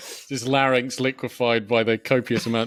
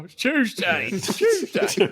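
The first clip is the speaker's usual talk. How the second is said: higher, slurred Cockney mimicry; mock Cockney; 9 semitones higher, slower than usual. Friend mimics Statham's Cockney, higher and laughing. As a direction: mumble it like a Cockney having a stroke. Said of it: "Are you having a stroke".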